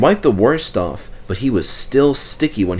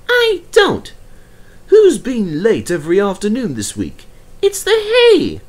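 protesting engine; 12 semitones higher, 4 dB louder than usual. Acted. Percy protesting.

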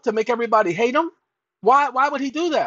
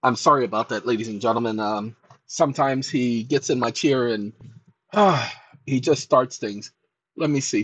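much lower, smooth announcer voice; deep late-night host voice; deep host persona; 12 semitones lower than usual. Acted. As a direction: apologize to the ladies and gentlemen in a deep, cool voice. Host persona takes back over.

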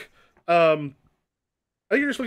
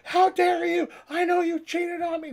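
high-pitched outraged shriek; screaming accusation; 12 semitones higher than usual. Acted. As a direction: scream it as a betrayed lover. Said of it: "sort of screaming".

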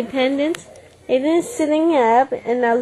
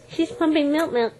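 usual speaking voice

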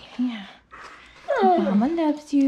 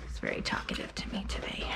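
whispered aside; 14 dB quieter than usual. Real, not acted. Owner whispering an aside while grooming a restless dog. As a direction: whisper it so the dog stays calm.

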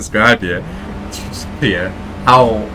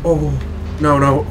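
ordinary talk, nothing marked

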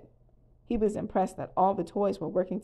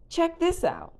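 much higher, eager call; excited yell; 11 semitones higher, 4 dB louder, slower than usual. A narrator's reading. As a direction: call friends over to come and look. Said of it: "Slinky Dog yelled from the edge".

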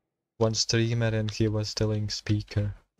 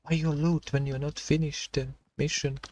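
mock scream; 5 semitones higher than usual. Gamer imitating viewers who will complain in the comments, mock scream. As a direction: imitate a screaming commenter without really shouting. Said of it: "gonna scream in the comments".

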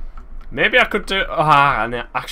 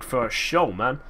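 normal talking voice

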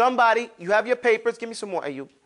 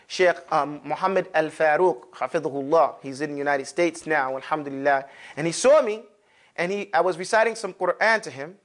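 ordinary talk

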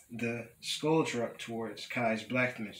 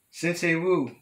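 higher, louder storybook call; cried out; 6 semitones higher, 6 dB louder than usual. A narrator's reading. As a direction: call out the name on spotting a rival. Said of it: "cried Samukai".

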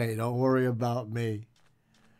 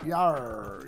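mock pirate. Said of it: "I wasn't a pirate".

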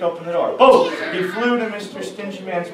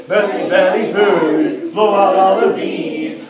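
loud; 7 dB louder than usual. Acted. Louder second half of song.

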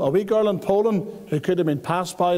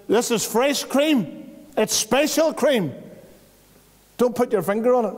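mock warning; 6 semitones higher than usual. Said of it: "whoever made it says".

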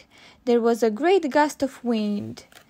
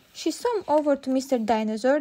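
ordinary delivery

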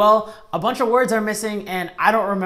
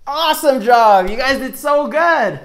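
louder, higher cheering praise; enthusiastic praise; 5 semitones higher, 8 dB louder than usual. Acted. Teacher cheering the kids after their recitation.

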